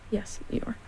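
soft, subdued little admission; sniffly reply. A narrator's reading. Piglet owning up to Eeyore about the balloon, subdued and sniffly. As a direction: answer quietly, with a touch of sadness. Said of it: "sniffing a little".

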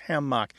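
hammock with a clear MOCK ending; second-syllable stress. Shown as a demonstration. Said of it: "stress on the second syllable".

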